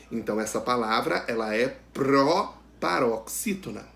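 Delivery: ordinary talk, nothing marked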